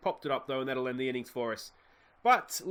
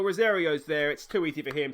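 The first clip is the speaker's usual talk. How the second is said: normal talking voice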